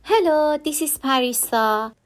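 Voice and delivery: usual speaking voice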